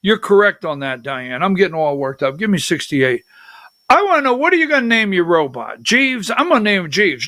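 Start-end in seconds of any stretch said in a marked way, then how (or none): none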